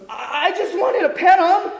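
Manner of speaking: high, loud squeaky stammer; panicked excuse. Acted. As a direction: plead innocence in mock panic. Panicked excuse, caught in act.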